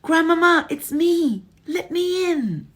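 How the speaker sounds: high, loud pleading call; boy shouting to be let in; urgent shout. Acted. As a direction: bang on the door with your voice.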